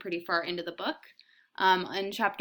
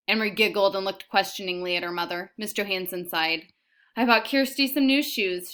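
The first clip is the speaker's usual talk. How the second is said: reading a novel aloud to students; steady read-aloud storytelling; story narration; 7 dB louder than usual. A narrator's reading. Teacher reads novel aloud.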